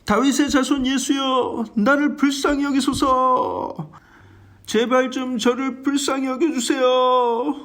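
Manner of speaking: high, slow pleading cry to Jesus; desperate cry. Acted. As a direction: beg at the top of his voice, drawn out. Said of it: "최대한의 큰 소리로 외칩니다". (높고 느리게 간절히 부르짖는 맹인)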